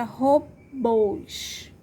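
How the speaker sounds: "robôs" split slowly, strong BÔS; "robôs" drawn out, long closed ô at the end; stressed final syllable. Shown as a demonstration. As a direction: separate and lean on BÔS in "robôs". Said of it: "A sílaba mais forte é bôs".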